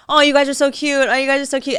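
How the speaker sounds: high, gushing fangirl mimicry; over-gushing imitation. Mimicking a girl commenting on the live, over-gushing. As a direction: mimic cloying, excessive praise in a high voice. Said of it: "a little bit too much".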